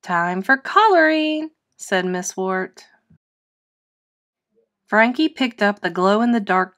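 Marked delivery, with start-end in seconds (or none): 0.0-1.5 teacher announcing. Acted.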